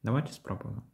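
usual speaking voice

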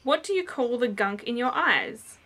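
ordinary delivery

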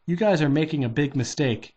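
plain reading voice

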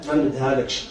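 low, quiet father's flat refusal; a father turning down the suitor, low and firm; stern refusal. Retold or imitated. Father refuses the drug-addict suitor, low and stern. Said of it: "قال لي".

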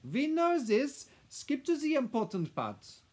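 put-on high, loud, imperious foreign-accented madame; impatient. A narrator's reading. Madame cutting through the lawyer's reading, impatient. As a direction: order him to hurry up, loudly. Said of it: "spoke impatiently to the lawyer".

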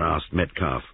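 plain reading voice

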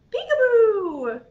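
loud, high playful burst; playful peekaboo call. Pretend. Storyteller playing peekaboo with toddlers, playful loud call. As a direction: pop out with a big, high peekaboo.

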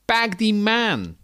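high, loud, drawn-out Utrecht shout; Utrecht accent. Acted. Utrechter calling out, loud broad Utrecht accent. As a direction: yell it in broad Utrechts with stretched vowels. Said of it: "Een Utrechter moet gaan uitvogelen".

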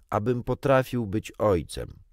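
plain reading voice

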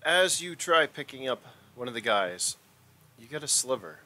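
a touch of dramatic scene setting; game master narration. A narrator's reading. Game master describing the approaching punks, lightly dramatic. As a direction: set the scene for the players.

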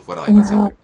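very loud, high-pitched command; explosive high yell of banishment; exorcism shout. Real, not acted. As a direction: shout the demon out with full force. Commanding demon out.

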